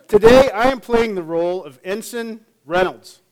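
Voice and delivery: usual speaking voice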